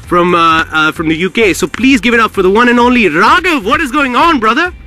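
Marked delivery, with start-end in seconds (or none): 1.5-4.7 high, rising big welcome; hype introduction. Real, not acted. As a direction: build up the star. Host introduces guest.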